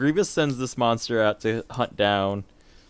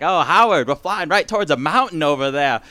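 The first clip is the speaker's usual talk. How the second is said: higher, hammed-up transatlantic accent like old Katharine Hepburn; 7 semitones higher, 3 dB louder than usual. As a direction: play a grand old-Hollywood transatlantic lady. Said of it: "The transatlantic accent".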